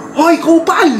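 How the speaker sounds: high mock yell of Hoy kupal; retold shout. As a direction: call out like a scolding grandma. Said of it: "Sumigaw si Lola". (pasigaw na panggagaya kay Lola)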